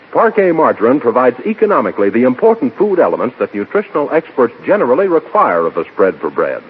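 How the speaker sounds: ordinary talk, nothing marked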